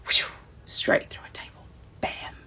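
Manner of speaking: breathy hushed whisper of wonder; awed whisper. Real, not acted. Reliving her favourite moment for fans, an awed whisper. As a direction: whisper it like you still can't believe it.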